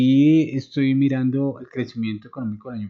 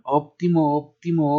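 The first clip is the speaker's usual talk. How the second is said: óptimo with the first Ó hit hard and higher; stress on the accented O; 5 semitones higher, 3 dB louder than usual. Shown as a demonstration. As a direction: lean on the Ó of óptimo, then drop the pitch. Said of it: "Con tilde en la O". (acento fuerte en la Ó de óptimo)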